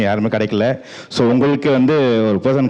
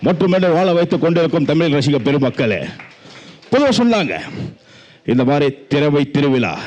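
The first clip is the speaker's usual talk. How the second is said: usual speaking voice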